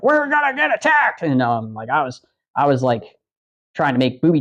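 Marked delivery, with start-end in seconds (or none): 0.0-1.2 panicked alarm. Retold or imitated. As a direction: voice jumps high in mock panic. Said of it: "So I'm like".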